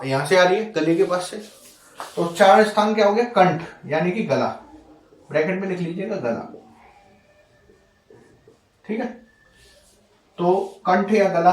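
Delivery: ordinary talk, nothing marked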